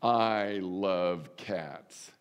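slow, weighty I love cats; emphatic. Pretend. For the cat lovers in the pews, said slow and emphatic. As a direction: lean hard on I and stretch the whole line. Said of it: "some of you can say it emphatically".

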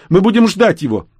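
high, loud insistence; stubborn woman. A narrator's reading. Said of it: "заупрямилась женщина".

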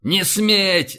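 high, forceful shout; yelling. A narrator's reading. Admiral forbidding the lieutenant's sacrifice, yelling. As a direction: yell a furious prohibition. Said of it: "заорал Рашен".

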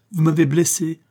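high, loud reproach; pained accusation. A narrator's reading. Wounded woman to the master, pained accusation.

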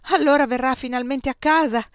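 calling out. A narrator's reading. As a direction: call it through to the salon. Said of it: "gridai verso il salotto".

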